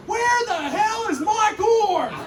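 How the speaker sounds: very high, slow, screaming mock rage; furious shout. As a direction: yell it across the whole store. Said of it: "all we hear is".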